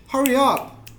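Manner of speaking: high, loud demonstration of hurrying someone; urgent call. Shown as a demonstration. Teacher acting out hurrying someone who is late, urgent call. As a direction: call out sharply to a straggler.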